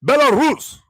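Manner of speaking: "Belarus" pitched high in a put-on Russian accent; hammed-up Russian accent on "Belarus"; mock Russian accent. Shown as a demonstration. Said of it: "Do I sound Russian?".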